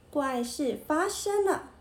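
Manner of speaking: usual speaking voice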